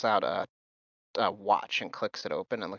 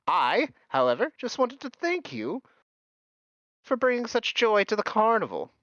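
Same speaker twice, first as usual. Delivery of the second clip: high, cheerful showman; Mister Light, beaming; 3 semitones higher than usual. Acted. Host praising the guests, high and cheerful. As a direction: beam with pride over the vane.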